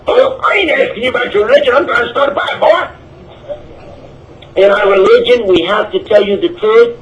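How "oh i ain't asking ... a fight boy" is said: mock heckler. Voicing heckler who wants fight.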